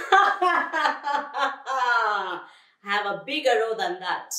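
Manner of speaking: loud, high cackle into words; laugh, then jeer; laughing mockery. Lizard mocking the lion's failed roar, laughing. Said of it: "laughed Lizard".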